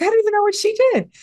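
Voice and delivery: mock exasperation. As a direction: blurt it out at a squeak. Baffled father protests.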